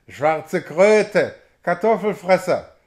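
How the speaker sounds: loud croaking devil's insult; harsh, shouted devilish jeer. A narrator's reading. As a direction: croak the abuse out loudly like a demon. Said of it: "krächzte der Teufel".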